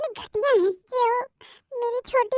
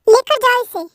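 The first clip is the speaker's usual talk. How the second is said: louder command to take her away; loud order; 9 dB louder than usual. Acted. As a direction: bark the order over her cries. Aunt repeats order louder. (ज़ोर से हुक्म)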